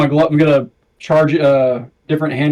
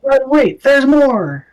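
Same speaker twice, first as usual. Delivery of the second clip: higher, punchy TV pitchman; infomercial pitchman; 10 semitones higher, much slower than usual. Retold or imitated. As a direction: sell it like a late-night ad. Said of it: "Billy Mays here for Eldritch Blast".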